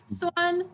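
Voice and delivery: SUAN high and level; "Suan" on one steady high pitch; first tone. Shown as a demonstration. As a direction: lead learners with a held high "Suan". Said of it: "it's the four tones".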